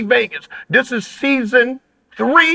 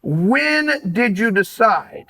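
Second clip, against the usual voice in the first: ordinary delivery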